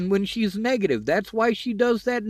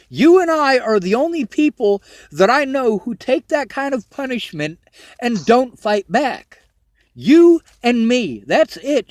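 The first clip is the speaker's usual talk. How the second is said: louder and higher, slowing down on the last words; emphatic; 3 semitones higher, 5 dB louder than usual. Real, not acted. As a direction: hammer the point home so she believes it. Insisting she isn't terrible.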